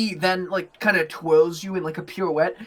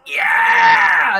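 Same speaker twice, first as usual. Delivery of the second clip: high, roaring cheer; triumphant innkeeper's yell; excited shout; 11 semitones higher, 8 dB louder than usual. Acted. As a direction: whoop it out at full volume.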